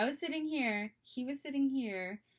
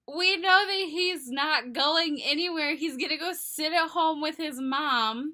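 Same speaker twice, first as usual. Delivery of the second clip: loud, high, emphatic reenactment; exasperated outburst; 4 semitones higher, 8 dB louder than usual. Retold or imitated. Telling her freaking-out friend he's going nowhere, exasperated outburst. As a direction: overpower a panicking friend with the obvious.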